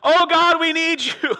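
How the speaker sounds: high, loud mock plea for help; mock pleading. Preacher mocking pious people begging God, in a hammed-up high plea. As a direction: ham up the desperate believer. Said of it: "people that are like".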